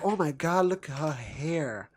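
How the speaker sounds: slow, lightly camp gushing; hammed-up swooning over hair, drawn out. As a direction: gush over her hair, a touch camp.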